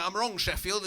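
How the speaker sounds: usual speaking voice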